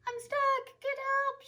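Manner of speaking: put-on high little girl's call; squeaky kid stuck up a tree; Tilly calling from the tree. A narrator's reading. Girl calls down from the tree, high and childlike. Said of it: "she says".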